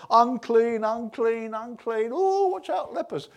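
high, loud cries of alarm; scared cry. Acted. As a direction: call out a frightened warning to keep away. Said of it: "scared out of their lives".

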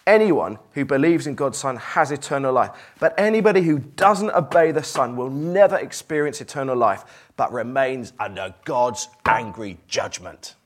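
loud, stern reading of judgment. Shown as a demonstration. Preacher reading the verse in a hard, stern tone. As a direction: read it as a harsh warning. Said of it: "Let me read it in one tone of voice".

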